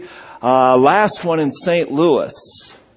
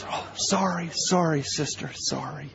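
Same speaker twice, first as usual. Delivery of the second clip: much softer, hushed sorry sorry; sheepish apology; 11 dB quieter than usual. Retold or imitated. Scolded man apologising to the angry sister, hushed. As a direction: shrink back and apologise under your breath.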